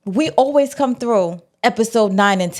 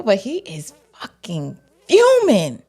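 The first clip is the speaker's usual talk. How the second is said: ordinary delivery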